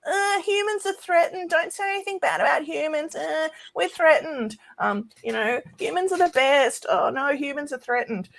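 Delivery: high, whiny mock panic about humans; mocking panicky humans. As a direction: squeal it like a scared, self-pitying crowd. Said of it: "all the humans were like".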